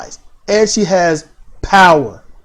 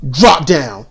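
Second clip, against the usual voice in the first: lower-pitched mock command; ringside manager's command; 5 semitones lower than usual. Imitating a fan playing manager on the outside, low command. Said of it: "she's a manager on the outside".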